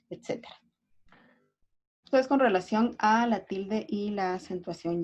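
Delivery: ordinary talk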